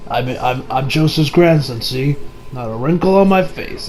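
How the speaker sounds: calm, low stoic hero voice; deep cool Jotaro. Retold or imitated. Jotaro calmly correcting the mother in a low, deadpan voice.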